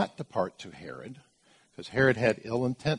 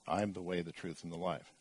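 a hushed, lower first reading of the line; soft, low read; 5 semitones lower, 11 dB quieter than usual. Shown as a demonstration. Preacher quietly reading Jesus's words before stressing them. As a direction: say it softly, low and dropping away. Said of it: "Very emphatic".

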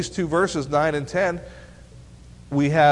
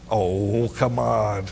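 drawn-out, low groan of reproach; playful exasperation; 4 semitones lower, much slower than usual. Real, not acted. Teacher chiding teens who will not answer, exasperated. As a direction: let the words sag with dismay.

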